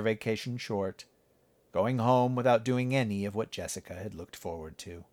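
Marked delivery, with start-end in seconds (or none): none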